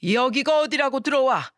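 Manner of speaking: an outraged father shouting at his son. Acted. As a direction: bellow at the son as he walks in. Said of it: "소리쳤습니다".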